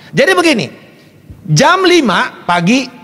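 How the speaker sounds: ordinary talk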